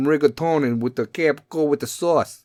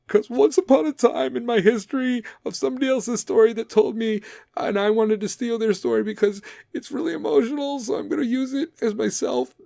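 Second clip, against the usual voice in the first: higher, whiny mock sob-story voice; melodramatic dieter; 7 semitones higher than usual. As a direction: ham up a fake tragic confession. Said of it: "they use words like".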